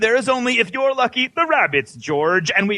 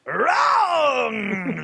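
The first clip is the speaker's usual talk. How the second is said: Wrong! cried out in a strained mock shout; buzzer wrong; 4 semitones higher than usual. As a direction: bellow Wrong! like a game-show host. Quizmaster rejects wrong answer.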